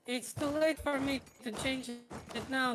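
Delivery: ordinary talk, nothing marked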